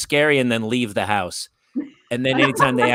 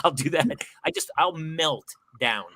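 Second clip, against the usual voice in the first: ordinary talk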